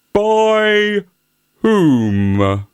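coy, simpering donkey voice; a coy little simper. A narrator's reading. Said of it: "simpered the donkey".

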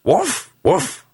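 high-pitched spoken woofs; dog barking. A narrator's reading. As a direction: bark the words like a small dog. The dog barks in the story.

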